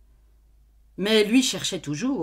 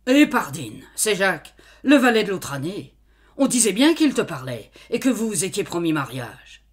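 loud, gloating discovery; triumphant exclamation; 2 semitones higher, 7 dB louder than usual. Acted. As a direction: crow over finding the secret. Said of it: "l'homme s'écria".

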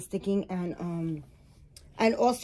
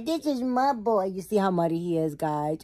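ordinary talk, nothing marked

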